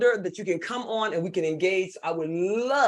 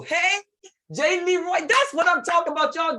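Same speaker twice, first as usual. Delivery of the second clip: high-pitched, delighted call-out; excited greeting; 9 semitones higher, 3 dB louder than usual. Real, not acted. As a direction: cheer them on as they join. Greets participant coming on screen.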